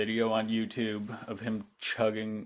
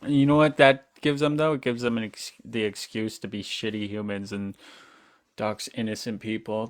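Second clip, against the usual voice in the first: ordinary delivery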